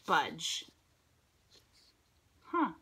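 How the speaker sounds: ordinary delivery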